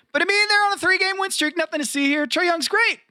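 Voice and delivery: high, fast mock cheerful; sarcastic cheer. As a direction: fake upbeat, tongue in cheek. Sarcastic repeat of Hawks bit.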